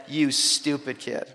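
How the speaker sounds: soft, slow, held-in reproach; quietly retold irritation; muted exasperation. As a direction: act out a disgusted thought kept under the breath. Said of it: "thought it deep in my soul".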